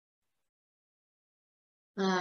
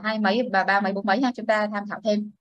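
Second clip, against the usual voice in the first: normal talking voice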